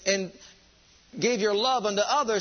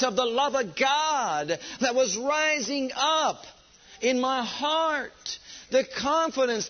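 high, slow, fervent sermon voice; preaching at full force; 6 semitones higher, slower than usual. Acted. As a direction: preach it out with fire.

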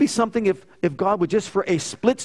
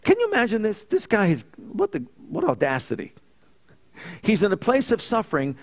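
normal talking voice

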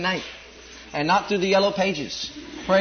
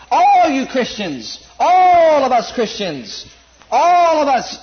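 slow, louder and higher preaching on "all"; preaching build; 4 semitones higher, 4 dB louder, much slower than usual. Acted. Preacher rousing the congregation about all. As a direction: rise into full preaching force.

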